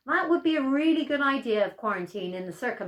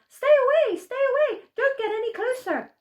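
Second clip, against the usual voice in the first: high, loud begging; a shrill plea to keep back; terrified grandma; 6 dB louder than usual. Acted. Grandma pleads with the wolf, high and frightened.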